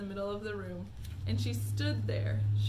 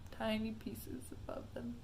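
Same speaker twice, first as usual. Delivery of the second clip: whispered aside; 6 dB quieter than usual. Real, not acted. Aside to audience.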